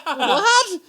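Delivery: high, loud incredulous what; shocked what. Retold or imitated. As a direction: blurt it out in disbelief. Retold reaction to stabbing story.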